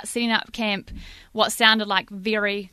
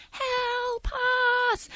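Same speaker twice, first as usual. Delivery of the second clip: high, soft, drawn-out wail; faint, high call for help from far off; faint cry; 13 semitones higher, 5 dB quieter than usual. Retold or imitated. Noise in the background of a night campground, faint eerie cry. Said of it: "starts getting a bit louder".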